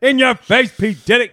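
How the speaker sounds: high, loud gloating; loud taunt. Real, not acted. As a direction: rub it in with a crow. Taunting his friend.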